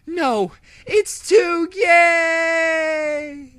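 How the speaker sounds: very high, slow, loud dying shriek; hammed-up villain defeat; villain's wail. A narrator's reading. Omega wailing as the bubble swallows her, very high and slow.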